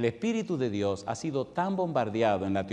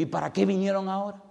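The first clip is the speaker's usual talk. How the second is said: pleading complaint; 6 semitones higher, faster than usual. Retold or imitated. Arguing with the angel in dream.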